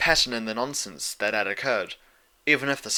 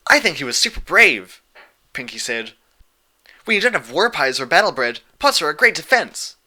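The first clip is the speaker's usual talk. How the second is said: louder, bubbly cheerful voice; bubbly Pinkie Pie; 5 semitones higher, 8 dB louder than usual. A narrator's reading. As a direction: gush like an excitable pony.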